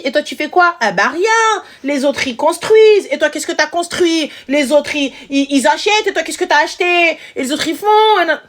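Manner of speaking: put-on high, reproachful mother listing what other girls do; nagging mother in French. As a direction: mimic a mother guilt-tripping her daughter. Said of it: "je vous l'ai fait en français".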